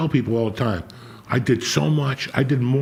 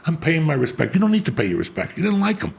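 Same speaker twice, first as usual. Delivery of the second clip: heated rant; 7 semitones higher than usual. Real, not acted. Ranting at fake mourners.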